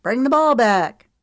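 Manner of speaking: loud, high call across the sand. Acted. Teammate shouts for the ball back, loud call. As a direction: yell it from the court. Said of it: "called out to him".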